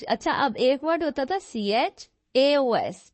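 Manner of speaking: ordinary talk, nothing marked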